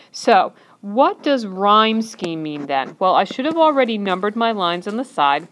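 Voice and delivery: usual speaking voice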